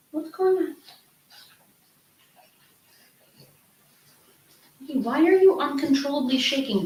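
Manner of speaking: high, soft coaxing voice to a frightened dog; worried pet talk. Real, not acted. Owner asks her shaking dog what's wrong, high anxious coo.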